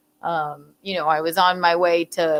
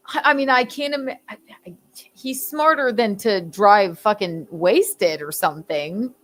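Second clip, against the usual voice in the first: ordinary talk, nothing marked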